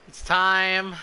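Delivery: loud, eager reveal of the big moment; excited announcement. Real, not acted. Streamer to chat, loud hyped announcement. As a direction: announce it like a grand occasion.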